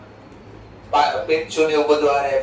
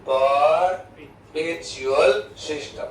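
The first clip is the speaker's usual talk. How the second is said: perpetual system drawn out even slower; slow dictation; much slower than usual. Shown as a demonstration. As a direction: stretch perpetual system for students to copy. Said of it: "એનું બીજું નામ બી છે". (perpetual system ખૂબ ધીમે લખાવતા)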